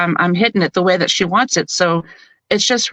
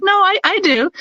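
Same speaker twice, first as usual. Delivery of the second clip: high, insistent protest; 11 semitones higher than usual. Retold or imitated. To the director who calls him too nice, high indignant protest. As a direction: squeak out a defensive no.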